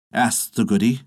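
ordinary delivery

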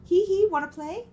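high squeaky toy voice. Retold or imitated. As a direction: chirp it like a talking toy. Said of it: "my daughter's Minnie Mouse toy go off".

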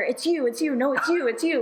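high mock accusations tossed back and forth; gegenseitiges Beschuldigen. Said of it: "quizzing each other".

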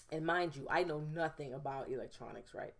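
ordinary talk

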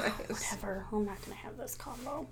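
breathy unvoiced aside; whisper. Real, not acted. Host muttering she's done with this argument, whispered. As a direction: whisper it off to the side. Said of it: "whispered aside".

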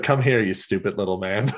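lightly voiced, low drunken Hex, amused; a hint of tipsy villainess, pitched low; drunk Hex impression. Host retells drunk Hex calling Bob over, low and amused. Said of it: "she was like".

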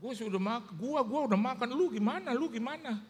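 high-pitched imitation of chummy small talk; teman Chinese. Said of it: "Ada yang begitu, Saudara". (tiruan obrolan akrab bernada tinggi)

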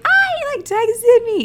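very high giddy squeal; freaking out happily. Retold or imitated. Recalling a text from the twin flame, giddy squealing. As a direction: squeal it, re-enacting the thrill. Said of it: "and I'm freaking out".

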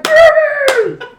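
put-on high, loud "Baby!"; excited shout. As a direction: yell it with delight. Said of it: "they're like".

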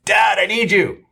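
high, loud, drawn-out call to Dad; son calling out. Retold or imitated. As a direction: imitate a son calling for his father, slow and high. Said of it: "all I heard was my son say".

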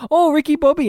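high, hammed-up French accent; imitating a French racing driver. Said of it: "in his French accent".

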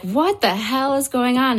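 high-pitched startled question; retold alarm. Woken camper asking roommate about strange noises, startled and high. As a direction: act out bewildered alarm, pitched well up.

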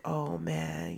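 ordinary talk

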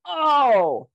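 high, loud delighted "Oh, score!"; excited cheer. Retold or imitated. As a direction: cheer it like a lucky win. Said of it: "And I was like".

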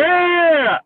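high, loud victory whoop; excited cheer. Real, not acted. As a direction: whoop like you just won. Cheers at a pick.

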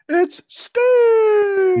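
screaming an entrance call at full pitch; announcer yell. Pretend. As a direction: belt it like a ring announcer. Hyping Sting's entrance.

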